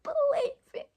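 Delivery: squeaky, breaking mock-sob pleas climbing very high; hammed-up tearful squeal; imitated bawling. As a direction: act out hysterical begging until the voice gives out. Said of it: "I was bawling".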